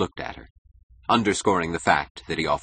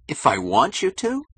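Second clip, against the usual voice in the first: high-pitched, raised-voice question; incredulous cry; 10 semitones higher, 4 dB louder than usual. A narrator's reading. Keating answers Dominique's condition, crying out. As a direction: cry it out in disbelief. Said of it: "cried Keating".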